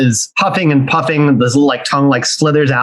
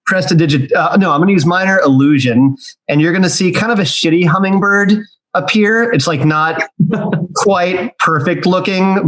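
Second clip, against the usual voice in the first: normal talking voice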